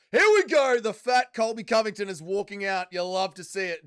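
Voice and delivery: loud, high hype for the walkout; hyped up. Real, not acted. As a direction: shout it like a ringside fan. Hyping a fighter's walkout.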